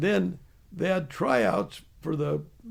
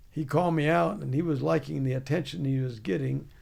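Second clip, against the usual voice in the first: ordinary delivery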